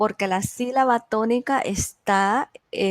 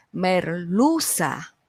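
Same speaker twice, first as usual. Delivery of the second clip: slow "merluza", LU stretched and raised; stress on LU; 3 semitones lower, much slower than usual. Shown as a demonstration. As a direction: sound out mer-LU-za, leaning on "LU". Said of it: "Una palabra llana".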